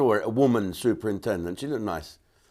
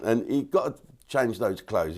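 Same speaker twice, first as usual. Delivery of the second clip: usual speaking voice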